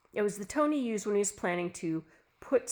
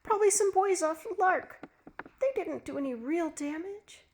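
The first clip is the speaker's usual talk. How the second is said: pleading wife; 10 semitones higher than usual. Acted.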